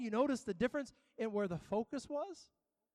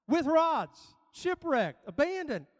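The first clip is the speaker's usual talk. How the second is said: punched hardships; 6 semitones higher, 5 dB louder, slower than usual. Acted. Preacher punches each hardship.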